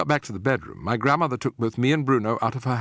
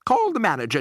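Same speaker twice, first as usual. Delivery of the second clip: haughty lady; 11 semitones higher, 5 dB louder than usual. A narrator's reading. Mrs Jenkins ordering husband.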